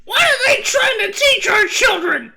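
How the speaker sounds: very high, loud, shocked mom voice; outraged parent. Retold or imitated. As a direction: shriek it like a scandalized parent. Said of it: "their parents would be like".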